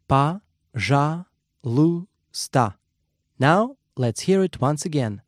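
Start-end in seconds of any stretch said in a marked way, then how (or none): 0.1-1.1 Па-жа-лу-ста spaced out syllable by syllable; broken into syllables. Shown as a demonstration. Teacher breaking the word down for learners, syllable by syllable. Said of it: "Let's break it down by syllable".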